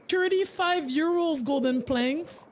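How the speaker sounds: ordinary talk, nothing marked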